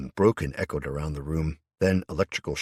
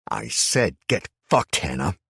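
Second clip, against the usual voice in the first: snarl; 5 dB louder than usual. A narrator's reading. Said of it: "I snarled at her".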